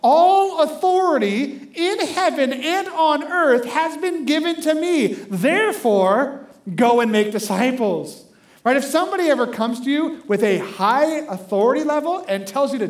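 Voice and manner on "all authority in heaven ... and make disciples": slow, loud, ringing scripture reading; preached scripture. Acted. Preacher booming the Great Commission to the congregation, slow and loud. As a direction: proclaim the verse with full pulpit force.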